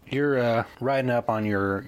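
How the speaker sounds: normal talking voice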